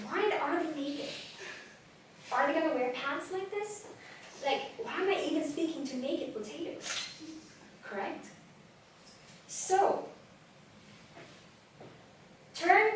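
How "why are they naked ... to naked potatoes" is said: higher, softer puzzled inner thoughts; confused inner voice. Retold or imitated. Speaker mocks a distracted speaker's baffled thoughts, higher and softer. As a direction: mutter to yourself, baffled. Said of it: "You will be thinking".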